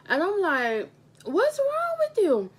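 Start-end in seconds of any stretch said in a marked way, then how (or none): 1.2-2.5 high, startled and a bit slow; teacher alarmed at a crying boy, high startled voice; alarmed question. Retold or imitated.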